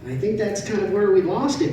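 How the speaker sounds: normal talking voice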